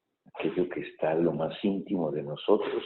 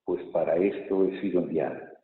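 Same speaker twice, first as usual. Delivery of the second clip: raised, solemn proclamation; gospel reading; 4 semitones higher, 3 dB louder than usual. Real, not acted. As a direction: read it out to the whole church.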